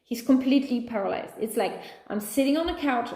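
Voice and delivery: ordinary talk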